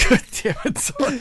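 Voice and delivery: cursing through a laugh. Real, not acted. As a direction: squeak it out mid-laugh. Laughing fit while talking.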